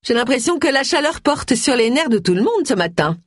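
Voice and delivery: high-pitched social brightness through a smile; Gloria, falsely breezy. Acted. Busybody excusing the quarrel to onlookers, high and breezy. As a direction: pitch it high and play it airy.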